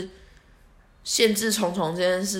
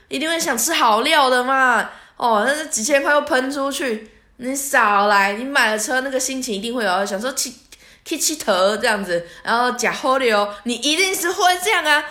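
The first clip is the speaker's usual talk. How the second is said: louder and higher, knowing insistence; insistent lecture; 6 semitones higher, 5 dB louder than usual. Retold or imitated. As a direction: tell her you know her type. Driver insisting she'll splurge.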